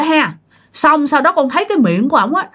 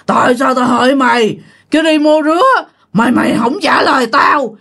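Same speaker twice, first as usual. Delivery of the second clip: lightly hammed-up giant spirit, a touch more threatening; more menacing ghost voice. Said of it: "cái giọng nói của ổng càng thấy ghê nữa, nó ồm ồm".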